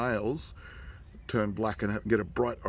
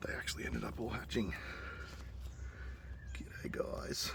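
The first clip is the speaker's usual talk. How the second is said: whisper; 11 dB quieter than usual. Real, not acted. Hushed near the nest.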